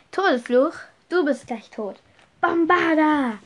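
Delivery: shouted duel threat; called-out spell. A narrator's reading. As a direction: cry out a curse at a foe. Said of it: "rief Max".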